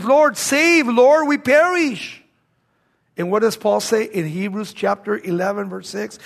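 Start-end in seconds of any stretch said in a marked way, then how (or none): 0.0-2.2 Lord, save cried out louder and higher; a desperate cry for rescue, slowing at the end. Acted. Frightened disciples cry out to Jesus, loud and pleading. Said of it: "they're actually crying out".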